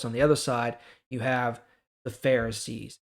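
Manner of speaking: ordinary talk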